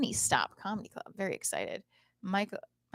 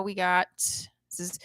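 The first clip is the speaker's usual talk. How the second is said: normal talking voice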